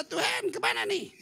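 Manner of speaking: high-pitched acted plea; pleading prayer. Preacher acting out asking God for help, high plea.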